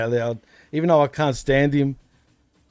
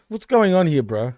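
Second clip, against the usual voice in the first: ordinary talk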